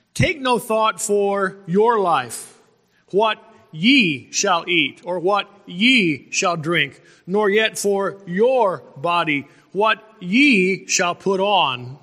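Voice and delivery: slow, deliberate reading, punching "your" and each "ye"; stress on your and ye. Shown as a demonstration. Preacher showing the congregation a pattern in the verse, "your" and "ye" slowly emphasized. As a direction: lean hard on "your" and "ye", setting them apart with pauses. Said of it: "I'll emphasize the words".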